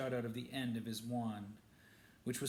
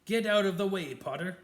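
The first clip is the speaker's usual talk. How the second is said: higher, much louder furious order; furious shout; 7 semitones higher, 10 dB louder than usual. A narrator's reading. Snape ordering Harry aside again, full furious shout. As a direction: blast the command at him.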